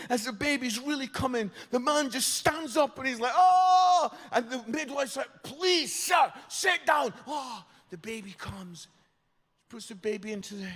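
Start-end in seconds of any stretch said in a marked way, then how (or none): 3.3-4.1 high, very loud "oh"; alarmed yell. Retold or imitated. Man leaping up during the birth, mock alarmed yell. 5.4-7.1 high, loud, slowing plea; exasperated command. Retold or imitated. Midwife to man who keeps standing up, exasperated shout.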